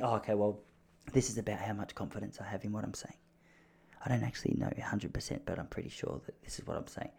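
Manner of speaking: very soft, low, hesitant murmur; just above a whisper. Shown as a demonstration. Unsure speaker admitting doubt, just above a whisper. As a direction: drop almost to a whisper, barely committing. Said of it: "maybe it's just above a whisper".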